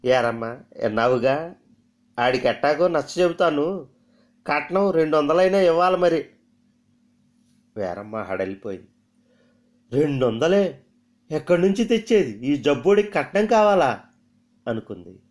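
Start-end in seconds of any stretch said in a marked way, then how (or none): 0.0-6.2 put-on haggling old mother. Mother demands dowry. 10.0-14.0 higher and louder, aghast at the sum; shocked dismay. Acted. Veeramma shocked at dowry.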